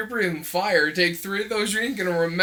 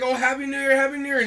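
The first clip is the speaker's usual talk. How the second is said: cheery greeting; 8 semitones higher, faster than usual. Retold or imitated. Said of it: "someone's just like".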